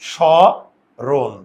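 স্মরণ drawn out as sho, then ron; loud, slow স্মরণ, split in two; broken into syllables. Shown as a demonstration. Teacher counting two syllables for students, স্মরণ split slowly. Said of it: "এখানে দুইটা syllable আছে".

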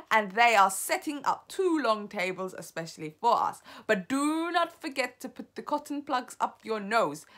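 loud, imperious witch's orders with high peaks; commanding witch leader. Acted. Grand High Witch briefing her assembled witches, commanding. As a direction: bark out orders to your underlings.

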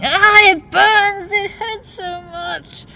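high, slow hammed-up whimper; mock agony. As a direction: overact the pain like a drama queen. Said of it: "them going".

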